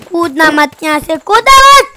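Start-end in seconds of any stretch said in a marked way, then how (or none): 1.2-1.9 high, loud warning cry; urgent shout. Real, not acted. Warning teammate not to jump, sharp shout. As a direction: stop him with a yell.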